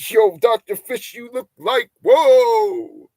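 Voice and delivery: high, loud put-on voice of a fan; hammed-up gasping fan; mock amazed chatter. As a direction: play a stunned viewer calling out. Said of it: "voicing a chatter's reaction".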